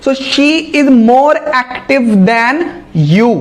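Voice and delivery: very slow, word by word example; carefully dictated English sentence; slow dictation. Shown as a demonstration. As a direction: read the example slowly for students.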